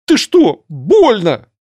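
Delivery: high shrill indignant blurt; shrill screeching bird woman. A narrator's reading. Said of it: "выпалила она".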